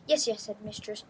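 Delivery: ordinary delivery, nothing marked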